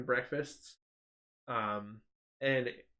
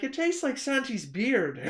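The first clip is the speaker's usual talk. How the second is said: high, slow, loud mock Irish; Irish accent; 11 semitones higher, 5 dB louder, slower than usual. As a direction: exaggerate an Irish lilt, drawn out. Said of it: "speaking with an Irish accent".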